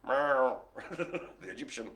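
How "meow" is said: imitated cat's meow; one drawn-out meow. Host punning on the Egyptian Mao, meowing like a cat.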